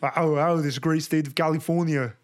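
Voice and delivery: thick Austrian accent impression; exaggerated action-star Austrian voice; Arnold Schwarzenegger. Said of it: "my favorite's Arnold".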